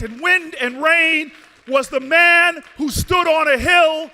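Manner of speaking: loud, rising, slowed preaching cadence; preaching at full force. Acted. Mayor to the rally crowd, preaching at full force. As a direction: thunder it out like a pulpit sermon. Rousing speech climax.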